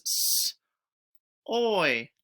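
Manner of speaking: sounded out phoneme by phoneme; stretched sounds. Shown as a demonstration. Teacher modelling the new word, sounded out. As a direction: stretch each sound apart. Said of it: "Let's change it".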